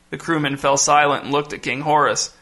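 ordinary delivery, nothing marked

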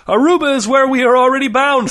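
high, loud, declamatory character voice; grandly proclaiming Dob. A narrator's reading. Dob tells Jean they're already bound for Aruba, grandly proclaiming. Dob announces their fated destination.

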